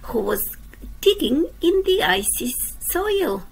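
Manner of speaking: lively storybook reading for little ones; reading to children. A narrator's reading. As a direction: read it like a bedtime picture book. Reads a picture book aloud.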